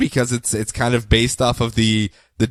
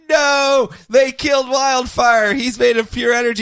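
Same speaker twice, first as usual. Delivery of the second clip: exasperated outcry; 9 semitones higher than usual. Retold or imitated. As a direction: wail it like a shocked fan. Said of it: "every single time it's like".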